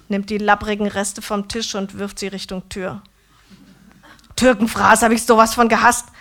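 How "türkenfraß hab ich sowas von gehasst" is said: loud, disgusted outburst; angry rant. A narrator's reading. Döner man raging about his childhood food, loud. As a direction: hurl the words out in disgust.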